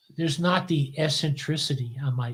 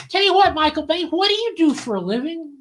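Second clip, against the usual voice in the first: high, punchy questioning; 9 semitones higher, 4 dB louder than usual. Retold or imitated. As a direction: imitate a star sizing up a stranger, pitched up. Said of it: "[name] looks at me, and he goes, like".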